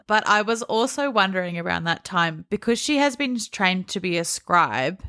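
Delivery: ordinary talk, nothing marked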